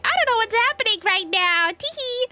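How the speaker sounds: high airhead voice, giggly; dumb confused voice. Retold or imitated. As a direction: play clueless and giggle. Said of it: "staring at it like".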